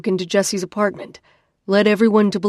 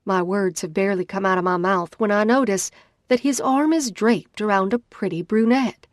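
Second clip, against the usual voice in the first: usual speaking voice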